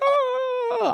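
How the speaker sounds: very high, drawn-out "oh"; mock crowd amazement. Everyone reacting to a surprise guest, high mock amazement. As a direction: squeal an astonished "oh".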